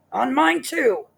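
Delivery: high, loud indignant shout. Acted. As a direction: bellow it back at her. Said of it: "shouted Mister Twit".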